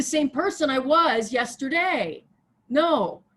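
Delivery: fired-up rallying sermon; impassioned preaching. Real, not acted. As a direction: preach it loud and high, urging them on. Preaches at full force.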